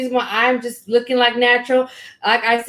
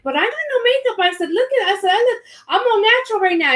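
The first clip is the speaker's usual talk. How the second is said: higher pitched pleading; whiny protest; 9 semitones higher, faster than usual. Retold or imitated. Asked to go live without makeup, higher whiny protest. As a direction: plead with a whine. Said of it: "I'm like".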